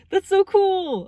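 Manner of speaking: high, loud laugh breaking into the words; giddy laughing joy; laughing delight. Real, not acted. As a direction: laugh it out, thrilled. Laughing in delight at own reading.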